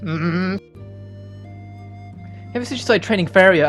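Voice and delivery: ordinary talk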